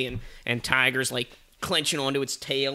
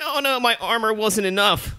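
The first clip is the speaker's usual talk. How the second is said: high-pitched mock dismay; dismayed armadillo; 9 semitones higher than usual. Armadillo skewered by the unicorn, in high mock dismay. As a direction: wail it as a cartoon armadillo. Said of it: "And he's like".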